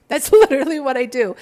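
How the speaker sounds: amused, owning it with a laugh. Shown as a demonstration. Laughing to her viewers about her own work. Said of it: "I'm aware and I don't care".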